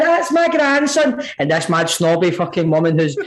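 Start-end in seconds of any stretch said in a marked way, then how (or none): 0.0-1.2 put-on higher, slow, proud old lady announcing; proud granny. Granny boasting to shoppers about her grandson, proudly. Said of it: "my grand's like".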